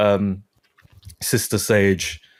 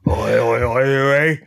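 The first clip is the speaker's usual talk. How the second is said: drawn-out mock Cockney call, rising; hammed-up Cockney hollering; Cockney accent; 2 semitones higher, slower than usual. Host imitating a video for co-host, hammed-up Cockney accent. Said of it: "With a, with a Cockney accent".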